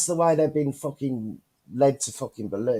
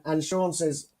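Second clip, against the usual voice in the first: ordinary talk, nothing marked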